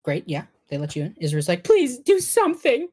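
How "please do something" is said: high, loud desperate begging; panicked plea. Acted. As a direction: beg the doctor frantically, high-pitched. Panicked parent begging the doctor.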